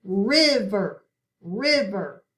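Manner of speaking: River said as "RIV-er", short i; River with first syllable like "give", stressed and falling; short-i reading. Shown as a demonstration. Teacher tries the second coding aloud, short-i River. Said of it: "Now let's say it".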